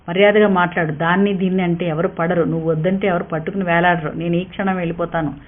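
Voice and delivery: usual speaking voice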